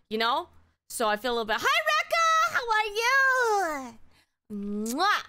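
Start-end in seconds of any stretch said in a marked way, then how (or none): 1.6-4.9 very high, drawn-out squeal of welcome; excited greeting. Real, not acted. Breaks off to greet arriving viewer. 5.0-5.2 big smacking kiss noise; blown kiss. Real, not acted. Blows a kiss to viewer.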